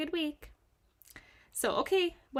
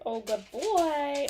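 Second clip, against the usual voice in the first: praising a dog; 3 semitones higher, 5 dB louder, slower than usual. Real, not acted.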